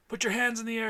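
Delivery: barked command; yelling. Acted. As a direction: shout it at gunpoint. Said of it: "And I yell".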